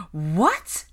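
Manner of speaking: loud, astonished one-word question; gaping shock. A narrator's reading. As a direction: blurt it in disbelief. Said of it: "Pansy gaped at her".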